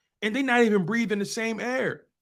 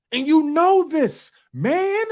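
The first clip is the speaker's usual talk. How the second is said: slow, high and loud, stretching out the catchphrase; Chris Tucker impression; 8 semitones higher, 5 dB louder, much slower than usual. Host channels Chris Tucker to rub it in, high loud catchphrase. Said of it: "Shout out to my man, Chris Tucker".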